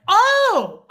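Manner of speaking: loud high startled oh; startled yelp. Retold or imitated. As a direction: yelp in shock. Caught by the granddaughter.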